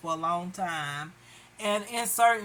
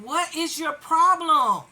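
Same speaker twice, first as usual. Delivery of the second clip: loud, high exasperated demand; raised-voice challenge; exasperated confrontation; 7 semitones higher, 7 dB louder than usual. Retold or imitated. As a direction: demand an answer, fed up. Confronting the pursuer in imagination.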